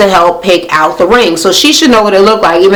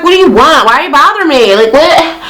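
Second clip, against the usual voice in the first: annoyed mock voice; 7 semitones higher than usual. Annoyed cast member snaps at Sam.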